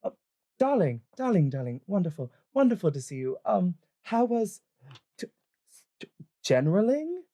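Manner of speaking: high, over-sweet greeting to a darling; stammering, high, caught-out voice; flustered half-elven woman. Acted. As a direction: cover panic with effusive affection. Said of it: "shuts the door and goes".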